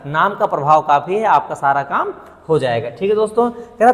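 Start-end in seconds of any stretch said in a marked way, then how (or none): none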